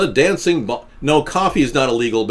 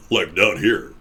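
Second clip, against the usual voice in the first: pitched lower, down in the chest; 6 semitones lower, slower than usual. Shown as a demonstration. Streamer showing chat his low range, low voice. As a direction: drop the voice low. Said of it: "I would like to, uh, to stay low".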